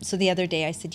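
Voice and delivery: ordinary delivery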